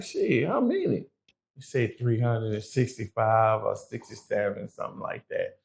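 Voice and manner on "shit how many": surprised. Retold or imitated. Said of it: "So I'm like".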